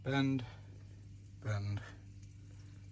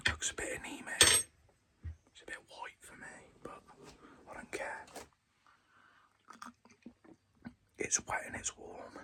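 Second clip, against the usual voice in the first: whispered aside about the tea; whispering; 7 dB quieter than usual. Real, not acted. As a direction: mutter it under your breath. Tasting his tea aside.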